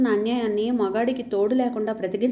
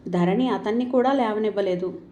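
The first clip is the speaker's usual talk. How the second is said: ordinary delivery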